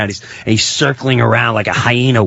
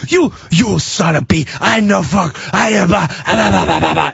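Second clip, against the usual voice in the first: higher, broken-off mock rant; sputtering rage; 6 semitones higher than usual. Pizza man curses back at the neighbor, sputtering mock rage. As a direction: imitate an angry man sputtering into nonsense.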